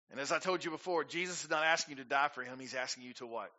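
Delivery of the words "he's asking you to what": normal talking voice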